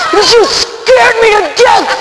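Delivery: high, drawn-out scared holler; mock-terrified outburst; startled shout. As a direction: cry out as if frightened half to death. Startled man exclaims in story.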